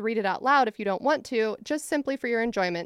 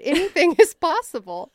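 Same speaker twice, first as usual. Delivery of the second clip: laughing; 7 semitones higher, 9 dB louder than usual. Real, not acted. Laughs while reading.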